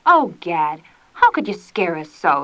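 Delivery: ordinary delivery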